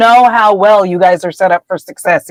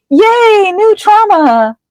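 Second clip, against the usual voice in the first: high, drawn-out fake celebration; mock cheer; 10 semitones higher, much slower than usual. Client greeting a fresh therapy discovery, high mock cheer. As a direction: sing out a hammed-up little hooray.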